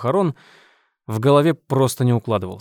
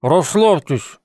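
louder mumble through stitched lips; mumbling; 4 semitones higher, 4 dB louder, slower than usual. A narrator's reading. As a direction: push the word out past the stitches. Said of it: "прошамкал Тед".

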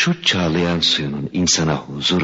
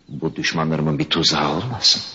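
ordinary talk

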